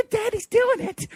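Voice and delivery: very high mock-child giggle and squeal; hammed-up high childish tittering; eerie kids' laughing. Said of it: "there's an eerie laughing riot going on".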